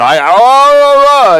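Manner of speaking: high, drawn-out oh oh oh in a put-on voice; his stage character's voice. Shown as a demonstration. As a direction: show off how the stage role sounded. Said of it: "And I talk lion".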